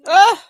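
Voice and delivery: loud high yelp of discomfort; sudden loud yelp; sharp cry. Real, not acted. Reacts to the nasal swab.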